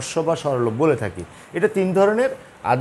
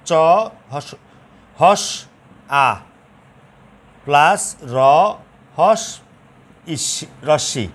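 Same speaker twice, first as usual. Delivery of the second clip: slow spelled-out demo; slower than usual. Shown as a demonstration. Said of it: "তো খেয়াল করো এখানে".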